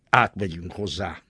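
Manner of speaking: plain reading voice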